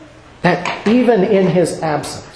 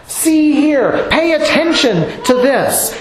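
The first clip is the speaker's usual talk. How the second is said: higher, pressing; pitched up like calling someone to look; urgent look here; 7 semitones higher than usual. Shown as a demonstration. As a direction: grab their attention. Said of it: "But it means".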